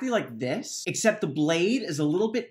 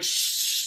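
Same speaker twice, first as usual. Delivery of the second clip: soft whispered shush; urgent whispered shh; hushing shh; 4 dB quieter than usual. Acted. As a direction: hush him quickly. Tam silencing Rand.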